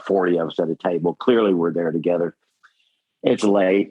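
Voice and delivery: normal talking voice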